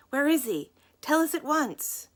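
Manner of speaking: bully her for an answer; menacing shouted demand; angry demand. A narrator's reading. Thugs shaking an old woman.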